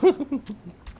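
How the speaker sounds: quick bright laugh; a short burst of laughter. Real, not acted. Laughs at own remark.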